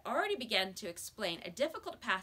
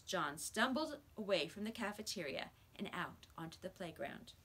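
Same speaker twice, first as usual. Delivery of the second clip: usual speaking voice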